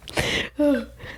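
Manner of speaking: shaky emotional sigh; teary sigh. Real, not acted. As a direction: sigh through held-back tears. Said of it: "got teary thinking about it".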